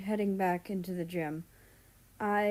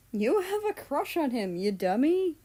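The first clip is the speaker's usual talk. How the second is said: teasing; 7 semitones higher, 4 dB louder than usual. Acted. Mal teasing Russia.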